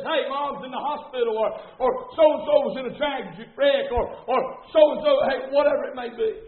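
fervent preaching with loud bursts; urgent preaching. Real, not acted. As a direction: warn the congregation with mounting urgency. Preaches at full force.

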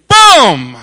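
loud shouted gunshot boom; sudden loud boom of a rifle. Retold or imitated. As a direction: bark out the rifle shot. Gunshot sound in hunting story.

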